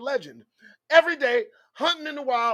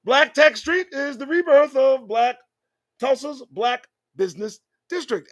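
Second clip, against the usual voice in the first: reads the headline aloud, louder and pointed, as if to expose it; loud mocking read-out; 5 semitones higher, 7 dB louder than usual. Shown as a demonstration. Host rereads the headline to his audience, loud and scornful. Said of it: "Read it".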